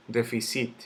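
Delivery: déficit stressed on CIT, defi-CÍT; wrong final stress. Shown as a demonstration. Teacher rejecting a wrong version, déficit stressed on final CIT. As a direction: land on the last syllable of déficit. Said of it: "yo no digo déficit".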